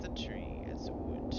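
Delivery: hushed whisper, breathy and unvoiced; whispering tree. The tree whispers its answer to the woodcutter. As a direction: breathe the tree's words as a secret. Tree character speaks in game.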